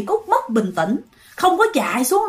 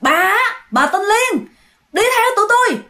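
insistent mock order; 7 semitones higher than usual. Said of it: "hai ông này nhất quyết".

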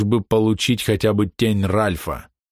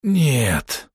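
hoarse; 5 semitones higher than usual. A narrator's reading. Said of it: "хрипло сказал Александр".

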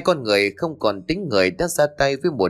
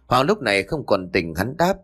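ordinary delivery

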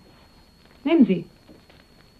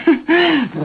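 short laugh; 7 semitones higher than usual. Acted. She laughs off his hint about coming back after the cocktails. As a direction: laugh him off lightly.

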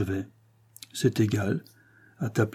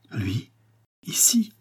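faint astonished murmur; slower than usual. A narrator's reading. Henriette seeing him suddenly appear, faint with surprise. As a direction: gasp it weakly, close to fainting. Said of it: "murmurait la voix faible d'Henriette, prête à s'évanouir de surprise".

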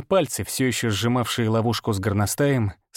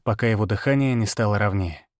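plain reading voice

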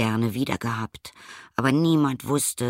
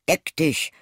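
magic command; 6 semitones higher than usual. A narrator's reading.

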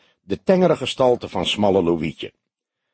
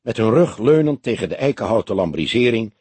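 ordinary delivery, nothing marked

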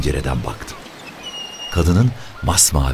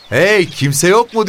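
high, loud, drawn-out holler; hollering high for someone to answer; shouted call; 6 dB louder, slower than usual. A narrator's reading. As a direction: call out to see if anyone's there. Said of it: "diye bağırdı".

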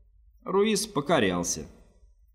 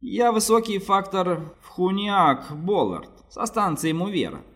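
higher, slower pompous merchant; 8 semitones higher, slower than usual. A narrator's reading. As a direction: announce yourself grandly as a trader. Fat man introduces himself.

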